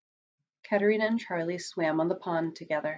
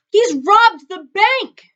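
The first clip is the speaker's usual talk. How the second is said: slow, high, loud outcry; drawn-out shocked yell, pitched high; shouting; 13 semitones higher, 10 dB louder, slower than usual. A narrator's reading. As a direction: raise the alarm, stretching the words. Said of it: "shouted the mayor".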